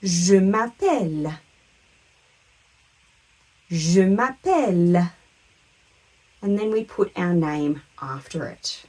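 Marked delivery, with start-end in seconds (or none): 0.0-5.1 slow "je m'appelle", stretched on the last syllable; drawn-out teacherly "je m'appelle"; French model phrase. Shown as a demonstration. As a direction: model "je m'appelle" clearly for repetition. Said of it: "To say my name is".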